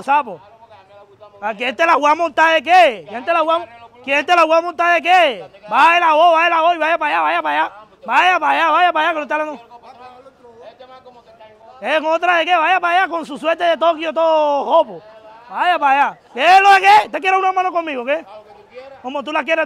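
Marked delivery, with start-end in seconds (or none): 1.4-9.7 loud, higher-pitched confrontation; heated street-quarrel shout; angry shouting. Real, not acted. Turns angrily on an intervening bystander. 11.8-18.2 loud, raised-pitch fighting talk; heated, aggressive yelling; angry challenge. Real, not acted.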